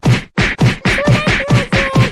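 loud, long drawn-out wail; boy wailing as the teacher hits him; pained wail. Acted. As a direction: howl it while being beaten. Boy being beaten by teacher.

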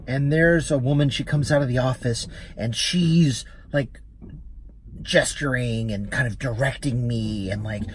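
ordinary talk

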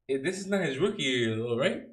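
normal talking voice

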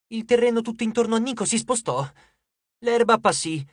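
ordinary delivery, nothing marked

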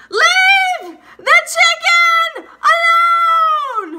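full-out high scream, every word stretched; long, piercing hysterical shriek. Retold or imitated. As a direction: scream it as loud as you can, tearfully. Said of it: "And I scream".

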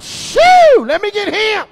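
very high, loud squeal; shrieking mock buyer. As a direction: squeal out a greedy claim. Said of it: "I'm like".

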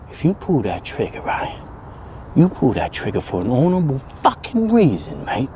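low and soft, deadly serious; facing the gun, quiet and grave; low grave murmur. Acted.

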